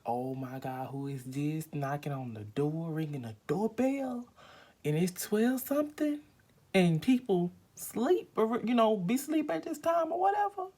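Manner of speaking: high, loud incredulous complaint at the late knock; alarmed retelling. Retold or imitated. Woken at midnight by knocking, she rants in high alarm. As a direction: pitch up and let the outrage build. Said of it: "I was like".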